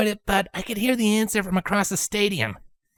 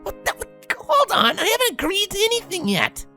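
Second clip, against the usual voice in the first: high, spluttering protest; splutter, then object in a high voice; flustered high-pitched objection. A narrator's reading. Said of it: "Rainbow Dash protested".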